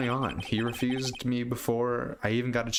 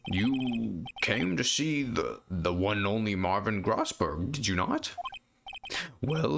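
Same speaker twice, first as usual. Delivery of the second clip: put-on pompous old lawyer. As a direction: savour every word like a grand old man. Grossberg voiced in game.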